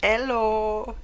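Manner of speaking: put-on high, loud Indian accent. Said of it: "Indian accent".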